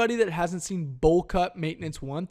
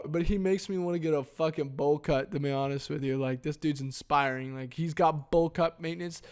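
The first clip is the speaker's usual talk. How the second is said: normal talking voice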